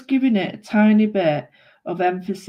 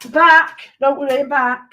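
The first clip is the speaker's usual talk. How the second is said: calling out; 6 semitones higher, 8 dB louder, slower than usual. Real, not acted. Calling item back, loud.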